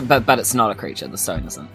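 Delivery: ordinary talk, nothing marked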